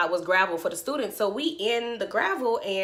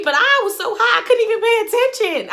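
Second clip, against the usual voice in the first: high and loud, rushing it; loud, fast, excited storytelling; excited telling; 12 semitones higher, 7 dB louder than usual. Real, not acted. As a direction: rush it out, thrilled at how high you were. Excitedly telling how high she was.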